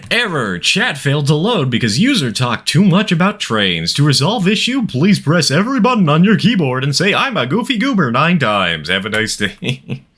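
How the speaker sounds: lightly robotic system announcement; mock error message. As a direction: read it like a computer error.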